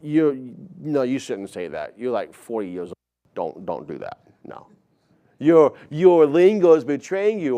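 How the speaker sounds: students telling the coach off, half-mocking; a hint of a teen voice, scolding; lightly voiced teen scolding. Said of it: "figure like".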